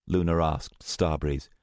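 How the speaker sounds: plain reading voice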